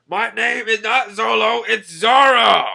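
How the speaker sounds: high, loud mock-angry shout; hammed-up outraged insistence; indignant correction. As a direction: holler it, stretching the final name. Acted Zoro corrects his name.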